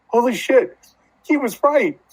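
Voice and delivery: excited. Retold or imitated. Everyone's like, excited quote.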